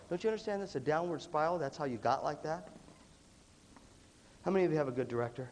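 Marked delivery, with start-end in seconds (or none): none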